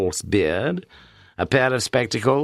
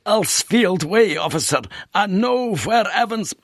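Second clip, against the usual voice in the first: slow, panting words; out of breath; 12 semitones higher, slower than usual. Acted. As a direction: gasp it out, winded. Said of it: "He was breathing heavily".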